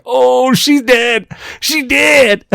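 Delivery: high, slow mocking singsong; slow high taunting voice; mocking friend. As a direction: drag it out high, gloating over her doom. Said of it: "Shenzi laughing his ass off like".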